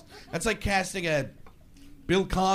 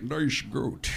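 mock Connery; 6 semitones lower, 4 dB quieter than usual. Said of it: "he'd be like".

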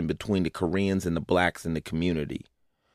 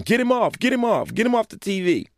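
very high-pitched frantic producer; high, urgent mock yell; mock panicked yelling; 13 semitones higher than usual. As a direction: imitate a producer yelling in a squeal, without much extra volume. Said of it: "The producer started yelling".

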